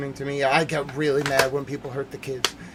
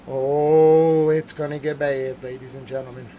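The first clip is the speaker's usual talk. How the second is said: usual speaking voice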